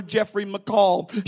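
ordinary delivery

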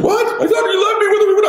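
put-on high, loud, panicked child voice; imitating a startled, frightened son; scared kid. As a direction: squeal it like a frightened boy. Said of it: "looking at me all scared".

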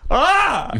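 sudden high startled yelp; mock scream. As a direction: yelp like you just saw a crash. Mock scream imagining ball boy hitting knee.

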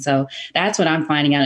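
ordinary talk

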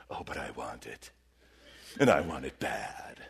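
very low, dark growl of temptation; devilish growl. Retold or imitated. Preacher voicing the flesh as a devil, low growl. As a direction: drop into a devil's voice. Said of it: "I sound like the devil all of a sudden".

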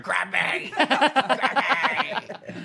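high, soft squeal breaking into laughter; mocking woman's plea. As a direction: imitate her begging, laughing as you go. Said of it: "a woman just going".

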